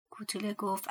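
plain reading voice

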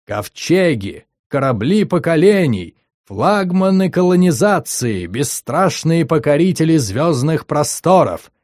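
медленно и громогласно, торжественно; прогреми, как диктор на параде; гремящий голос. Acted. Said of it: "прогремел голос". (громогласный диктор)

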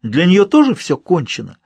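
high, slow, indignant questioning; outraged. A narrator's reading. Narrator challenging medium about his wife, outraged. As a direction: demand an answer, heated and pressing. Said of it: "Мне захотелось тут же задушить его".

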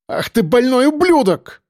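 high, raging outburst; яростный выкрик; furious shout. A narrator's reading. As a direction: hurl the insult at the top of the voice. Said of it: "выкрикнула Кобра".

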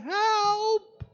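high call, hoping someone hears; calling for help. A narrator's reading. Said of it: "hij roept weer".

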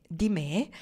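rising, mildly incredulous question; astonished question. A narrator's reading. Pocket asks whether she fears him, mildly astonished. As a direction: ask in understated surprise. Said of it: "Questa volta fu Pocket a stupirsi".

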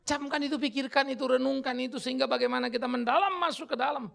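loud, high exhortation; preaching at full force. Real, not acted. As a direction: press the congregation to reflect.

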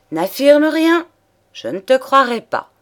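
loud, high angry cut-off; angry outburst. Acted. A mistress cuts off her lover's denial, with a loud high outburst. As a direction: shout him down, refusing to believe.

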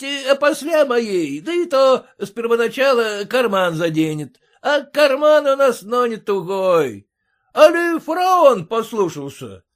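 loud, high boisterous shouting; rowdy merchant. A narrator's reading. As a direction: whip up the driver loudly. Drunk merchant urging driver.

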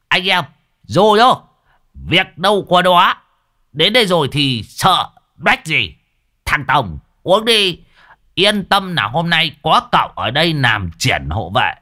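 put-on drunk-happy men yelling with a laugh; whooping. A narrator's reading. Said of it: "rú lên". (reo hò cười lớn phấn khích)

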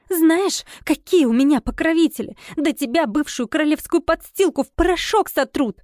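high-pitched indignant tirade; angry threat. A narrator's reading. Spotty guest threatens heroine with her patrons, angry. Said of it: "зашипела она от злости".

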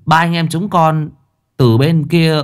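put-on respectful child voice, lightly done; polite child. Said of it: "lễ phép".

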